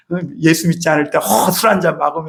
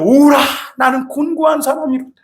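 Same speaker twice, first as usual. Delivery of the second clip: Paul's cry; 8 semitones higher than usual. Acted. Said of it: "바울이 오늘 비명을 질렀어요".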